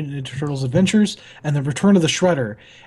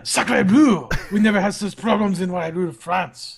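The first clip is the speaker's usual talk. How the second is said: put-on French accent; 2 semitones higher than usual. Voicing Napoleon character.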